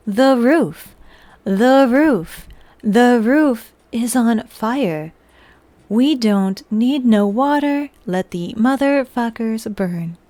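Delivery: slow robotic recital, word by word. Pretend. Streamer reads chat's typed lyrics in a slow robot voice. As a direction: chant the lines like a slow machine. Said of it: "the robot voice".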